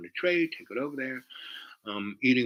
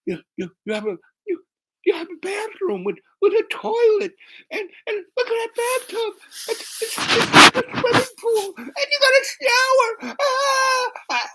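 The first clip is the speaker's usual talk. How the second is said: squeaky, very high tearful stammer building to a wail; hammed-up sobbing wonder, climbing into a loud cry; bawling girl; 5 dB louder than usual. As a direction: imitate a girl overwhelmed to tears, falsetto. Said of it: "And she starts bawling".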